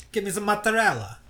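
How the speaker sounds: high, hammed-up Italian voice; mock Italian accent. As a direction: order mozzarella like a cartoon Italian. Italian-accent joke continues.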